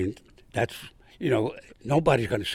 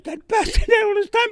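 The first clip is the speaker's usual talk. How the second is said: outraged outburst; 9 dB louder than usual. Retold or imitated. Outraged at the sergeant's trick.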